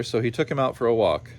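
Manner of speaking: plain reading voice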